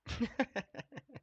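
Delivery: a high, rising burst of laughter; real laugh at the absurdity. Real, not acted. Laughs at the lawsuit.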